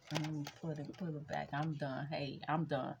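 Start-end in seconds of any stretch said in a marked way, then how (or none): none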